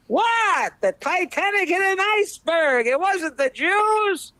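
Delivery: high, slow protest; indignant rabbi. Acted. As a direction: protest in disbelief.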